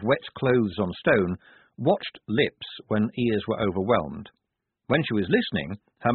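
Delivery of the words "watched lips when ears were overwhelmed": ordinary delivery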